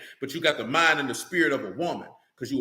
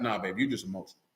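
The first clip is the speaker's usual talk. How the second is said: offhand dismissal; 9 semitones lower, 7 dB quieter, faster than usual. Retold or imitated. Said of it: "But I be like".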